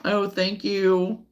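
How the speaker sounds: normal talking voice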